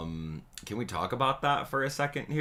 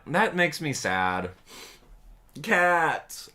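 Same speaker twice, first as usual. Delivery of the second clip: ordinary delivery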